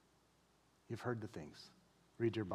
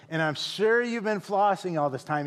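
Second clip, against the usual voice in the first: retelling the hygienist's doubting, sarcastic line, louder; 6 semitones higher, 10 dB louder than usual. Retold or imitated. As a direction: hygienist teasing the pastor about flossing, sarcastic and louder. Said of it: "she said it sarcastically".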